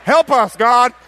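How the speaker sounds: short loud high shout for help; crying out. Retold or imitated. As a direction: imitate a desperate cry to God. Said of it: "I'm crying out".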